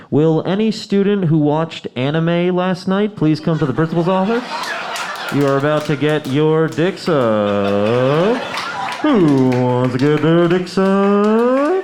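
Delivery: slow, loud announcer drawl over the PA; mock PA announcement. Comedian on the school PA calling students, slow and loud. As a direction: announce it like the principal on the intercom. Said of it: "I'll get on the P A and I'll be like".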